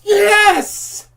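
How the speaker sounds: very high, very loud victory yells, easing off; triumphant screaming. Real, not acted. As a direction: scream with joy at surviving the night. Survived to 6 AM.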